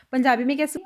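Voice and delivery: normal talking voice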